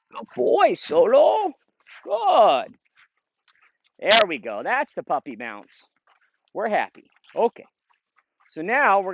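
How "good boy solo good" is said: high, loud, bright-eyed dog praise; excited high praise. Real, not acted. Trainer calling his dog Solo in, high excited praise. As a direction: cheer the dog on as he comes back.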